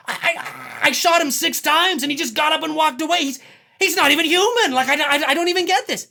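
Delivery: Doctor Loomis. Retold or imitated. Loomis insisting Michael can't be human, loud disbelief. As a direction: hammer home that he isn't human. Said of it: "Doctor Loomis is like".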